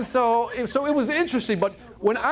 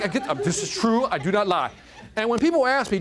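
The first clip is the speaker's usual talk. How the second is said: ordinary delivery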